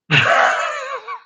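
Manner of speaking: sudden high yelp of a laugh; yelping laugh at a tough call; high shouted laugh. Real, not acted. Reacting to tough choice.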